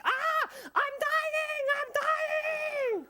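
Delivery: high, slow, hammed-up death cry; high melodramatic mock death wail; dying liar. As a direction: ham up the defeated liar dying.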